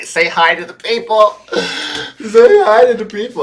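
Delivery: high, loud doggy talk. Real, not acted. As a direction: coo at the dog to greet everyone. Coaxing dog to greet viewers.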